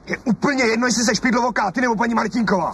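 high, sharp jab; heated insult. Acted. Husband calls his wife cross-eyed, heatedly. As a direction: spit the insult out.

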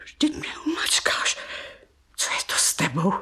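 startled whisper. Acted. Aunt startled, hushed.

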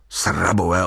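low-pitched mild scolding; scornful address. A narrator's reading. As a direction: address them low, with contempt. Scolding the frightened companions.

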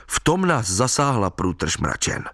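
plain reading voice

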